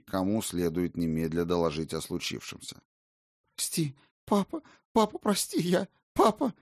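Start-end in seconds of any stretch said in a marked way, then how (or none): 3.6-6.5 put-on high, frantic little girl; incoherent mumbling. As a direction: babble it like a scared child. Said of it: "Бессвязно бормотала Айсонна".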